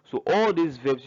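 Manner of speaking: ordinary talk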